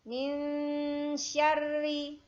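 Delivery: syarri with a short sya and doubled rr; short syllable demo. Shown as a demonstration. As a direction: sya kept short, rr held. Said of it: "syanya pendek".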